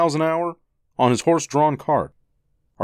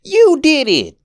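put-on high loud boy's accusation; angry child; 8 dB louder, slower than usual. Boy accuses his brother, high loud anger. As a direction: shout the blame at him. Brothers arguing over broken toy.

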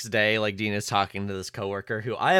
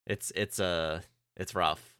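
normal talking voice

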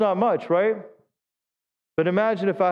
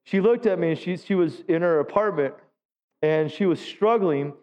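normal talking voice

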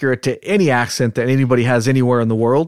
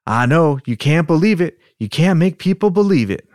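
put-on Southern accent; 5 semitones higher than usual. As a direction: plead it like a worked-up Southerner. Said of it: "practice with our Southern accents".

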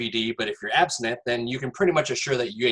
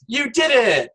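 mock cheer; 9 semitones higher, 4 dB louder, slower than usual. Mock cheer on novelty frame.

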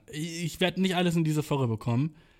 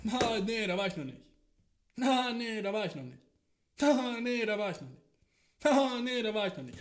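tinny voice from a phone speaker, quiet and repeated; his own voice looping from a phone; replayed phone clip; 5 semitones higher, 6 dB quieter than usual. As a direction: play it back like a looping phone video. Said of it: "hör ich von seinem Handy".

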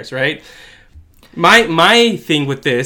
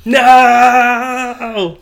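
mock shocked no; 8 semitones higher, 6 dB louder than usual.

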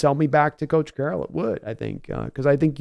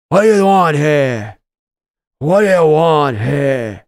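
loud, slow Pacino bark; 8 dB louder, slower than usual. Acted. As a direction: bellow it like Pacino losing it. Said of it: "'cause I love doing Pacino".

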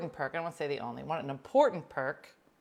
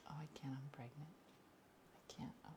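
mock whispered excuse; 2 semitones lower, 21 dB quieter, faster than usual.

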